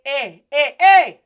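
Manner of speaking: loud eh eh calls to get attention; calling eh. Shown as a demonstration. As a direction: shout eh to catch his eye. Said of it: "Go ahead and say".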